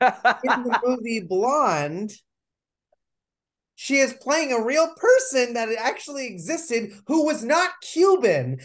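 indignant rant. Real, not acted. Ranting about Blonde casting.

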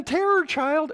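very high, loud mock shriek; imitating a screaming child. Lecturer imitates a colicky baby's sudden screaming, loud mock shriek. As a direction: shriek it like a colicky baby mid-fit. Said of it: "The child will scream and it'll be like".

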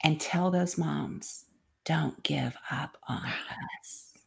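hushed, slow, choked whisper; emotional whisper. Retold or imitated. Son urging his mother to tell their story, whispered. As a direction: whisper it as a blessing. Grown son's blessing, emotional.

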